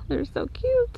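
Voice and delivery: cooing. Real, not acted. Cooing over baby animals.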